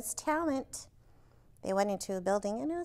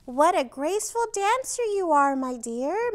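higher, louder delighted praise; exclaiming; 5 semitones higher, 6 dB louder than usual. A narrator's reading. Teacher praising the dinosaur's dancing, delighted exclaim. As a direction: gush over the dancer. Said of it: "Madame Lucille exclaimed".

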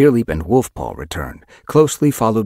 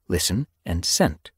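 ordinary delivery, nothing marked

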